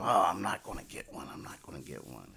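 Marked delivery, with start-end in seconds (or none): 1.3-2.3 murmured to yourself, much softer; quiet self-talk. Retold or imitated. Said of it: "I keep saying to myself".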